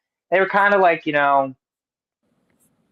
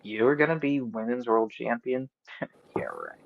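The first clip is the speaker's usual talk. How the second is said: scornful disbelief; 8 dB quieter than usual. Retold or imitated. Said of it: "she gave her a little bit of a".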